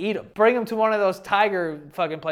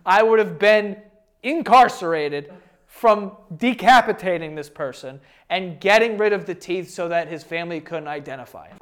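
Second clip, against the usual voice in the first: ordinary talk, nothing marked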